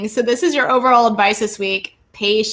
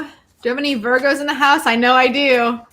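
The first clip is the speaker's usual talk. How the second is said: ordinary delivery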